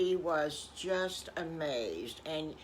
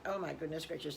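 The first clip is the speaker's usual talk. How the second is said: ordinary delivery, nothing marked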